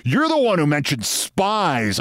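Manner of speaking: high, indignant retort; angry. A narrator's reading. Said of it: "That made me angry".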